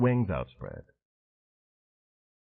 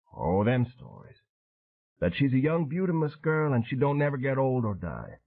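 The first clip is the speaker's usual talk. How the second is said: higher, rough-edged bayou man telling war story; matter-of-fact old sailor recalling the ambush; weathered Cajun veteran; 4 semitones higher than usual. Acted. Train telling Dan how his crew died, voiced.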